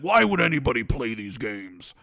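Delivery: mock the exec, low and pompous; low mock Jim Ryan voice. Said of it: "when Jim opens up his mouth".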